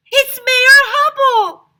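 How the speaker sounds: huge high, loud slow shout with a laugh. A narrator's reading. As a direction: yell it across the carnival. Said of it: "I shouted".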